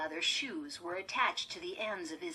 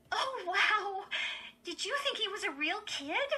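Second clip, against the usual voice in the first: high, mocking girl's voice; scornful; 5 dB louder than usual. A narrator's reading. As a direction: make fun of a sister's mistake. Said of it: "Lindy laughed scornfully".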